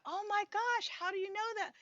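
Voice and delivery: put-on high, astonished old mom; a mother amazed her daughter guessed; mom's amazement. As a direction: gasp it out way up high.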